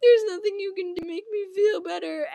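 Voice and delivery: high, sobbing wail imitating a boy; exaggerated tearful whine; mock crying. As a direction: blubber it through hammed-up sobs. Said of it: "literally crying".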